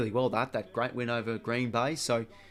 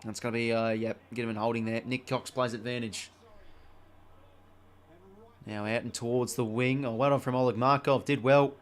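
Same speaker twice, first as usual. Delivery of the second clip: brisk footy commentator call, a touch louder; play-by-play commentary; 4 dB louder than usual. Acted. Gamer calling his footy match, brisk commentary. Calls the game he plays.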